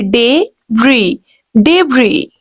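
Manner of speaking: debris as "deb-ree" with a silent s; correct debris. Shown as a demonstration. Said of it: "S will be silent here".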